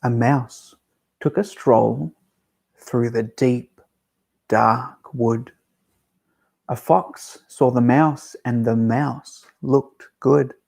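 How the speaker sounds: put-on slow, lilting read-aloud to children; gentle children's librarian narration; storytime narration. A narrator's reading. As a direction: read it like a bedtime story for little ones. Reads picture book aloud.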